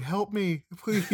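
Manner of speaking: playful high-pitched plea; mock damsel in distress. Host teasing guest about her game acting, mock damsel plea. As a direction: lightly imitate a corny horror-movie victim. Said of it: "damsel in distress type".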